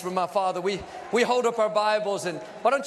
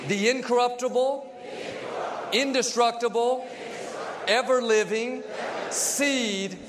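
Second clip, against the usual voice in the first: leading a congregational confession. Real, not acted. Preacher leads the church in a Bible confession, declaimed phrase by phrase. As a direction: declaim it in short phrases, pausing for repeats.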